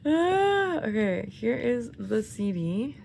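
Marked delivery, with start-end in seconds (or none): 0.1-0.8 high, loud fangirl shriek; excited squeal. Real, not acted. Fan opening the album shrieks with delight. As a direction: let out a thrilled shriek.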